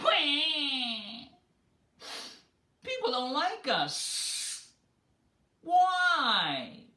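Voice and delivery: high, slow, whining wail; bewildered snakes crying out; drawn-out high lament of hurt snakes. Acted.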